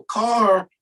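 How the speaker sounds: high shouted call of car; yelled warning. Retold or imitated. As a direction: call out car to warn the kids. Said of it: "someone would yell".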